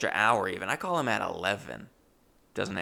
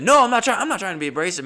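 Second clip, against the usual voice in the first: frantic denial; 9 semitones higher, 8 dB louder, much faster than usual. Retold or imitated. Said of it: "And I said".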